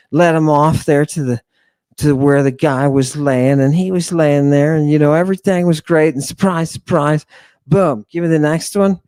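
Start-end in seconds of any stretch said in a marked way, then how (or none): none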